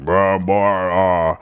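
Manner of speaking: put-on slow, low drawling old grandpa. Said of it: "an old grandpa voice where he's like".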